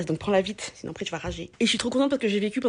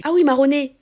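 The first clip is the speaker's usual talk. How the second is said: Marseille accent; 5 semitones higher, 7 dB louder than usual. Shown as a demonstration. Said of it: "tu vas marronner".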